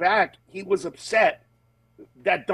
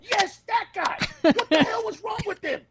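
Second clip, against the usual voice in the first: high, laughing mock outrage; laughing comedian. Comedian laughing with the others about the non-laugher, high. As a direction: giggle through it like a catty comic. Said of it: "the other comedians will be like".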